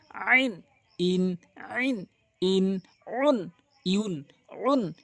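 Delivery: ইন উন drawn out slowly; slow, careful recitation of each syllable; Quranic letter drill. Shown as a demonstration. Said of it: "এটি হচ্ছে আন".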